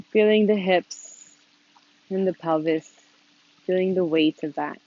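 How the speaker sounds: calm, unhurried instruction with long pauses; gently leading a seated meditation, leaving space between phrases; guided meditation. Real, not acted. Leads a meditation.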